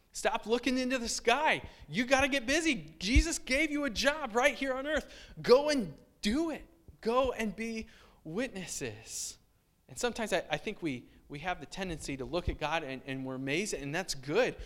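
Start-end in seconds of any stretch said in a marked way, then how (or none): 0.1-9.4 higher, louder angel urging them on; urgent angel. Retold or imitated. Angel scolding gazing disciples, higher and louder. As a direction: snap them out of staring, push them to go.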